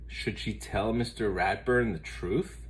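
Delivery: usual speaking voice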